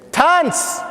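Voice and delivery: very high, drawn shout; yelled command. Retold or imitated. Guards to the last boy left, yelling. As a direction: shout the order high and hard.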